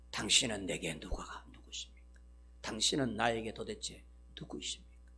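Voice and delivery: whispered, awed question; a soft whispered plea to the Lord; hushed whisper. Acted. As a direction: breathe it out as a quiet plea. Saul's hushed question to Jesus.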